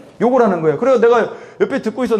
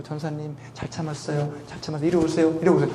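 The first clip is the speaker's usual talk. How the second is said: much quieter, lower imitated voice; soft soothing; 4 semitones lower, 7 dB quieter than usual. Girlfriend praises preacher for holding back, hushed soothing. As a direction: imitate gently coaxing someone away from a fight.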